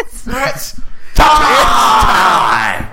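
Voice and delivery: high, loud fight announcer call; announcer shout. Pretend. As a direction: belt it like a ring announcer.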